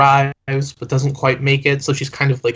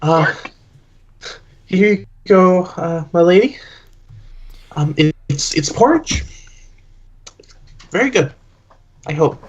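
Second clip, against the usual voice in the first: halting, slightly higher offering to a queen; hesitant server; 4 semitones higher than usual. Acted. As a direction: offer it up unsure, with awkward pauses. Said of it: "and kind of goes".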